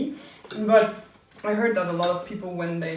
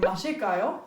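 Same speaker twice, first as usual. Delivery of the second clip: 마실까요 with the pitch rising at the end; rising intonation; faster than usual. Shown as a demonstration. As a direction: lift the tune up on 마실까요. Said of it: "the intonation goes up".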